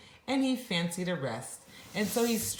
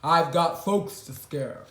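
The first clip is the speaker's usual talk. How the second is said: gruff troll; 6 dB louder than usual. Acted. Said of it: "he called gruffly".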